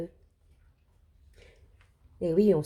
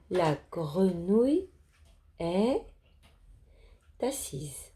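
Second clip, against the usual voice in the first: slow, deliberate word-by-word reading; lecture mot à mot; 4 dB quieter, slower than usual. Shown as a demonstration. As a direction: read slowly, pausing after each word or group. Teacher models reading word by word. (lecture lente, mot par mot)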